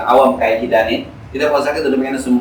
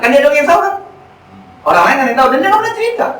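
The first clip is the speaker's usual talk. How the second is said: excited emphasis; 13 semitones higher, 4 dB louder, faster than usual. Real, not acted. Preacher stresses his point.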